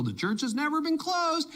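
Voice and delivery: high-pitched quoted slogan; mock proclamation. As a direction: mimic a proud social media declaration. Mimicking pastors' social posts.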